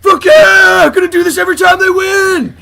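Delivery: high, full-out whooping yell; hammed-up victory scream; rowdy celebrating shout. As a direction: whoop like a riled-up sports fan. Plays rioting cop celebrating.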